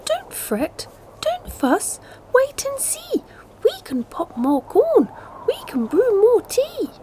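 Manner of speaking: very high comforting squeak; squeaky mouse. A narrator's reading. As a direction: soothe the bear in a tiny voice. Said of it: "Mouse squeaks".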